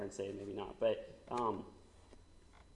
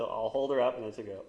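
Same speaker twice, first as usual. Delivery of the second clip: usual speaking voice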